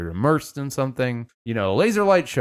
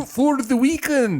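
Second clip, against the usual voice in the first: hammed-up fake Scottish parent; bad Scottish accent; 11 semitones higher than usual. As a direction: badly attempt Scottish, pitched way up. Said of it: "Can't do Scottish".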